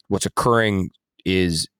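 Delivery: ordinary delivery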